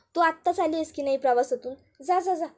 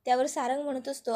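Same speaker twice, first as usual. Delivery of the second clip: ordinary delivery, nothing marked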